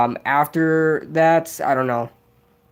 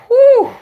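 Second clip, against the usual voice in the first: excited whoop; 8 dB louder than usual. Real, not acted. Reacting to Giants first pick question.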